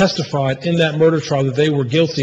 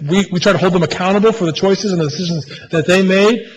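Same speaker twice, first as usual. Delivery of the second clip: ordinary talk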